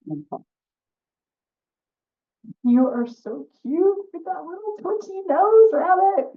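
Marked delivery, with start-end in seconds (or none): 2.6-6.3 high, loud gushing over the rabbit; sugary fox voice, much higher and louder; fawning fox. Acted. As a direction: coo at the rabbit like a predator.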